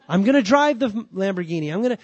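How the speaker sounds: loud, high, laughing mock boast; joking boast. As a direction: brag it up as a parody of prosperity preaching. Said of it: "I'm joking, kind of".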